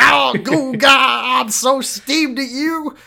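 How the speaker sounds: high-pitched, loud, slow huff; high huffy voice. Retold or imitated. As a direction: squeak it out in a huff. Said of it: "And then he's like".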